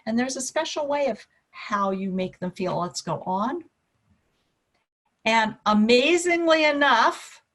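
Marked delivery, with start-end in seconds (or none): none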